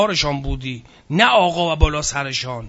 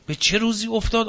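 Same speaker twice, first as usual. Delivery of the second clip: normal talking voice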